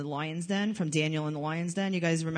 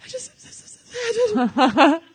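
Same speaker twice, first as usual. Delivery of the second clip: fake crying; 9 semitones higher, 8 dB louder than usual. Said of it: "She's like".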